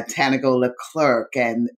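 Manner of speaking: ordinary talk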